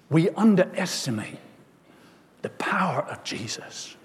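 soft, falling away to near-whisper; quiet, weighty statement with a pause midway; hushed preaching. Real, not acted. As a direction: let it sink in, dropping to a hush. Hushed first take of sermon refrain.

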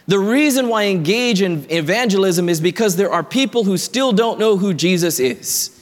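ordinary talk

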